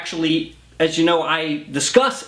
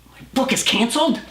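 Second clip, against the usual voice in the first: shocked; 8 semitones higher, faster than usual. Acted. Author learns book deal dropped.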